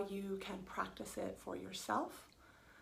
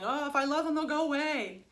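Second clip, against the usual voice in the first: higher, louder sly inner voice; the mind hoping love makes the feelings vanish, higher and louder; scheming mind voice; 6 semitones higher, 7 dB louder, faster than usual. Retold or imitated. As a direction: play the mind's little trick out loud. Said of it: "That's the mind saying".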